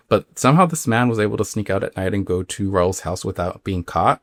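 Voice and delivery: usual speaking voice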